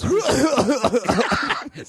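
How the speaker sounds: evil chuckle, hammed up; villainous laugh. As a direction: cackle like a cartoon villain. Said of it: "give me a villainous laugh".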